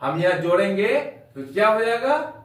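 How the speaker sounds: ordinary talk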